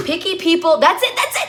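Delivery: high, loud eureka; excited realization. Real, not acted. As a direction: burst out as it comes back to you. Excited at remembering the jingle.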